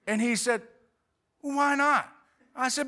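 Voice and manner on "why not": high, loud challenge; incredulous protest. Retold or imitated. Salesman challenging the homeowner's refusal, incredulous protest. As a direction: imitate a salesman balking loudly.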